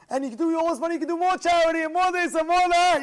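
high, loud, piling-up persuasion; insistent convincing. Acted. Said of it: "he's convincing him".

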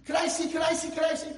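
put-on high, loud, pleading kid voice; eager little boy. Boy begging his mother to see the new bat, shouting eagerly. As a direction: beg like a kid who can't wait.

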